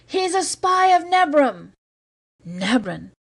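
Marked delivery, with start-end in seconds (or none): 0.1-1.7 high, slow, ugly accusing shout. Acted. A voice from the crowd shouting an ugly accusation, high slow shout. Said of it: "shouted again ... a very ugly sound".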